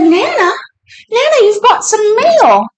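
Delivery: high singsong mock call. Telling Nana she has got mail, calling out. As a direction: imitate summoning someone with happy news.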